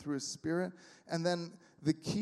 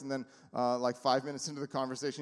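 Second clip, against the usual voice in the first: normal talking voice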